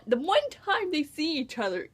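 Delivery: usual speaking voice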